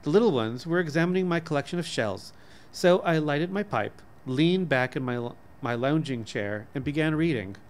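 usual speaking voice

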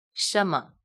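"什么" said as a slow model word with closed a; model word reading. Shown as a demonstration. Teacher reading the second example word to the class. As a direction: read "什么" carefully as a model word. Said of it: "也同样的道理".

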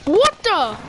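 sharp high loud "what"; startled. Real, not acted. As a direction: caught off guard. Sudden attack in game.

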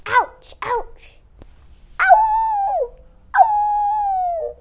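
high, loud yelps of pain; wolf crying out in pain. Acted. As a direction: yelp as if kicked in the face. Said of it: "cried out the wolf in pain".

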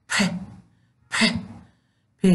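پ said as a bare short p sound; teacher demonstrating how پ sounds; letter sound. Shown as a demonstration. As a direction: make the sound of پ alone. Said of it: "چنین آواز داره".

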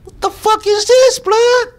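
high, loud disbelieving yelp; shocked outburst. Retold or imitated. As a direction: squeal it in outraged disbelief. Outraged at shackles in story.

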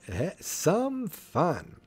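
high, slow, mock-tipsy drawl; tipsy driver. Hiccuping driver enjoying his ride, tipsy. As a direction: draw it out like a hammed-up drunk.

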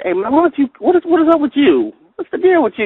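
high, incredulous mock friend voice; teasing friends. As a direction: pitch way up, mock disbelief. Friends teasing him about church.

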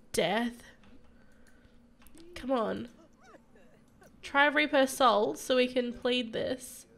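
usual speaking voice

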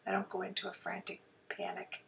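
sparing a sore throat, softly. Real, not acted. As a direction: ease off and speak softly to spare the throat. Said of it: "I'm gonna try talk softer".

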